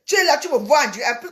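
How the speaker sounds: ordinary talk, nothing marked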